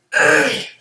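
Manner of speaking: sharp unvoiced throat clear. Real, not acted. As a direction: clear your throat, you have a cold. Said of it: "I'm gonna be coughing the whole time".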